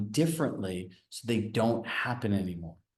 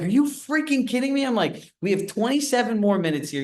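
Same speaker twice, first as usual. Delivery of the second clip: much higher and louder indignant outburst; freaking out; 10 semitones higher, 4 dB louder than usual. Retold or imitated. Speaker retelling blowing up at her manager, much higher and louder. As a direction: blow up in disbelief at the boss. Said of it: "I literally freaked out".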